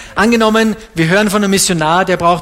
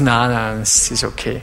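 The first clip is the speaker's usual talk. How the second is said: lower, softer mock brush-off; 9 semitones lower, 5 dB quieter than usual. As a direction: trail off dismissively, lightly voiced. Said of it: "Dann sagen sie".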